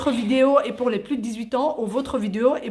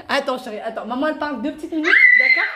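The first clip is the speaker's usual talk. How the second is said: laughing shout; 3 semitones higher, 4 dB louder, faster than usual. Real, not acted. Mother hushing child while filming.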